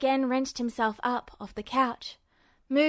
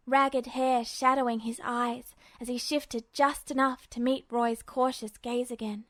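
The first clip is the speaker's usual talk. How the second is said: ordinary delivery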